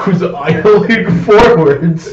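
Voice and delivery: can barely get it out for laughing; giggling while recalling a sleeping sibling. Real, not acted. Laughing while telling story.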